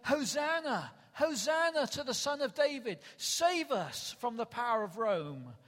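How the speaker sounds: high, loud cheer, settling at the end; retold crowd acclamation. Preacher re-enacting last week's crowd hailing Jesus, called out. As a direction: hail him like a jubilant crowd.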